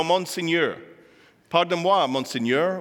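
careful French pronunciation; attempted French. Speaker quoting the queen at her execution, attempted French. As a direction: deliver the French as best you can. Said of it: "let me see if I've got the French right".

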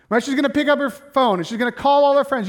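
normal talking voice

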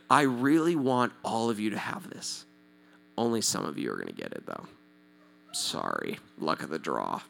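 low, quiet mocking voice; a low, muttered parody of a reluctant Paul; mock grudging apostle. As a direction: sarcastically play a stingy giver. Mock Paul quote, sarcastic.

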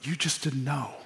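tender, hushed comfort; incredible compassion. Retold or imitated. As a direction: reassure them kindly that they just didn't know. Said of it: "Peter with incredible compassion saying".

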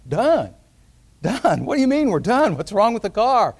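baffled, protesting questions that climb high; acted astonishment, pitch leaping up; incredulous. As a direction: echo the word in disbelief, then protest. Acted baffled son questioning father.

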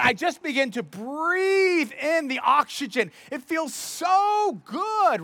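high, slow, drawn-out relish; blissful relief. Acted. Runner telling how stopping feels, blissfully relieved. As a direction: savour it, stretching the key words. Savouring breath after stopping running.

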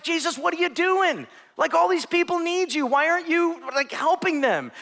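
high, pressing voice of panicked followers; frantic disciples. Acted. Disciples demanding to know why Jesus left the crowd, high and urgent.

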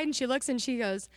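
normal talking voice

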